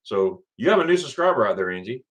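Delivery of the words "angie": breathy aside; whispered name. Real, not acted. Aside to the streamer.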